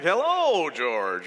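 loud, drawn-out "Hello, George" like a wife who has had enough; mock wife's greeting. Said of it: "looks at you like".

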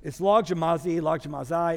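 logomaizi said as LAHG-zhuh-my-zee with a long final i; Texas pronunciation. Shown as a demonstration. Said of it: "so I say logomaizi".

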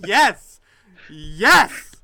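loud delighted yes shouts; loud gleeful yelling; excited cheer. Real, not acted. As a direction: cheer like your wish came true. Cheering at the played clip.

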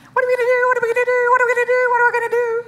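hammed-up panicky squeal; mock panic. Preacher mimicking panicking churchgoers, hammed-up frantic wail.

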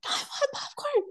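high-pitched imitation of an upset little kid; upset child. Mom imitates her daughter wanting grandma's popcorn, upset child voice. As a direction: whine it way up high like a small child. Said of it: "Reagan got really upset".

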